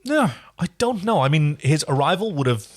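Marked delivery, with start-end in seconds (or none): none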